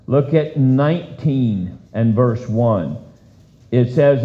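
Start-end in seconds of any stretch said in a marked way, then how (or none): none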